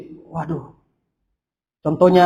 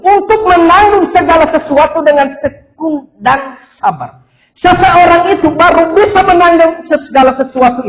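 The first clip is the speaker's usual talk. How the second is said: loud, high, driving sermon voice; fervent preaching; 10 semitones higher, 8 dB louder than usual. Acted. Pastor urging the congregation, loud fervent preaching. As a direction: preach it out to the whole church.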